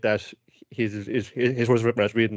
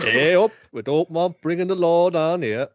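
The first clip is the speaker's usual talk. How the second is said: put-on higher, drawn-out stage Yorkshire accent; mock Yorkshire accent; 6 semitones higher, 3 dB louder than usual. Friend parodying the dub's old man, mock Yorkshire accent. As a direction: lay the Yorkshire accent on thick and slow. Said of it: "trying to do a Sean Bean impression".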